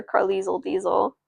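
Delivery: plain reading voice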